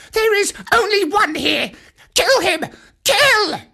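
high, shrill-free monkey yell; monkey shouting. A narrator's reading. A monkey alerting the mob, high frantic shout. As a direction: scream for the kill. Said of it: "a monkey shouted".

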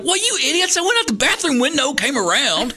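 teasing pastor. Retold or imitated. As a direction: rib the kids cheekily. Jerome teasing the boys.

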